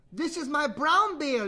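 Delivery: put-on high, loud, slow child's objection; frowning little sister. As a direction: object with a little girl's pout. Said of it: "Little Sister frowned".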